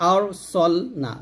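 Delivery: ordinary talk, nothing marked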